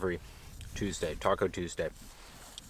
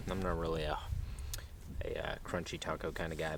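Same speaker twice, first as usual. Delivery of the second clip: usual speaking voice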